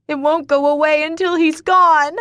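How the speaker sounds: loud, high anguished cry; desperate outcry. Acted. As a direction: cry it at him. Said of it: "I cry".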